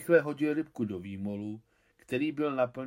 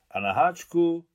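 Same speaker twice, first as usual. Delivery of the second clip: a sly, high, loud jab at na háčku; boy slyly asks about the hook; sly question; 8 semitones higher, 9 dB louder than usual. A narrator's reading. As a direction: needle him with a sneaky question. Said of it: "se zákeřně přihmouřenýma očima".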